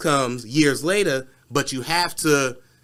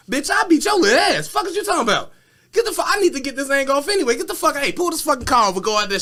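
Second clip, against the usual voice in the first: furious threat; 11 semitones higher, faster than usual. Retold or imitated. Voicing Meg's threat.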